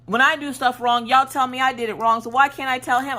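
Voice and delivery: put-on high-pitched childish protest; protesting kid. Daughter arguing with mom about fairness, protesting kid. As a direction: argue back like an indignant child.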